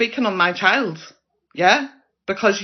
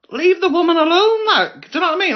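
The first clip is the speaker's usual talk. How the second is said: much higher and louder, telling them off; indignant shout; 10 semitones higher, 4 dB louder than usual. Real, not acted. Vlogger telling viewers to leave Liz alone, indignant shout. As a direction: shout them down in Liz's defence.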